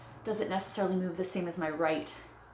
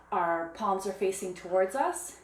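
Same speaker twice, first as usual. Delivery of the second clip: ordinary talk, nothing marked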